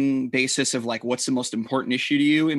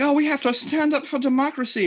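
much higher, mock British voice; hoity-toity British accent; 10 semitones higher than usual. Mocking the co-host's stand for democracy in a hoity-toity British accent. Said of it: "in your weird British accent".

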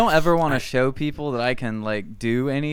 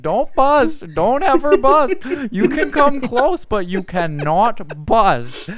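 higher, louder preachy mock rule-giver; mock Jordan Peterson; 10 semitones higher, 5 dB louder than usual. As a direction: bark out the rules like a self-help guru. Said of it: "Twelve rules for life".

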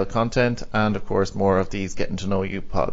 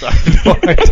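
high, loud burst of laughter through the words; laughing; 8 semitones higher, 9 dB louder, faster than usual. Real, not acted. Host baffled by own botched accent, laughing hard. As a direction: crack up at your own slip.

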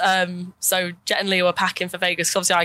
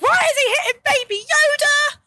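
very high-pitched reenacted scream; hammed-up distraught screech; screaming in outrage; 3 dB louder than usual. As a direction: wail it in horrified protest. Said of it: "I was screaming the entire time".

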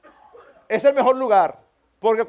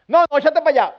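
louder, higher brush-off; mock rejection; 4 semitones higher, 5 dB louder, faster than usual. Parent pushing away a child wanting a hug, mock annoyed. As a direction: shoo someone away, hammed up.

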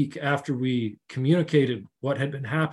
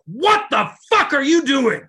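put-on a much higher, louder enraged shout at the kid; retold furious yell; 11 semitones higher, 8 dB louder than usual. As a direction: blast it at him in sudden rage. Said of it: "Just real rage and anger".

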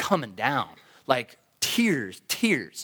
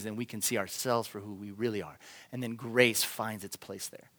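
low, soft, contemplative voice; hushed reflective preaching; 5 semitones lower, 6 dB quieter than usual. Real, not acted. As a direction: let it sink in quietly, with long pauses. Quiet reflective reading and reflection.

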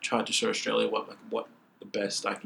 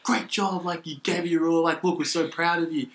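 higher-pitched imitation of proud fans; excited praise; 7 semitones higher than usual. Fans congratulating the athlete after competing, excited praise. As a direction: gush congratulations like a fan.

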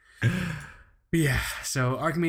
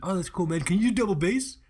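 eager bandmate; 5 semitones higher than usual. Retold or imitated. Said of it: "we were trying to find a drummer".

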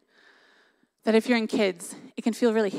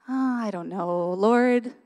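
slow, drawn-out retold doubt; imitating her own unsure reply to God; hesitant reluctance; much slower than usual. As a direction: drag it out reluctantly, as in a wary prayer. Retold reluctance to God's prompting.

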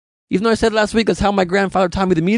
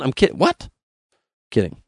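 usual speaking voice

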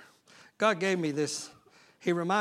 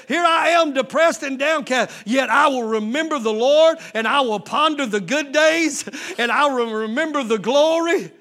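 preaching at full force; 8 semitones higher, 11 dB louder than usual. Acted. Preacher crying out David's lament to the congregation. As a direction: build it up until the cry of take courage. Preacher shouting psalm climax.

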